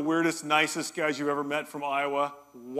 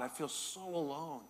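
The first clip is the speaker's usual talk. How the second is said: quiet lonely prayer; 8 dB quieter than usual. Real, not acted. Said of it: "And I'm saying that to God".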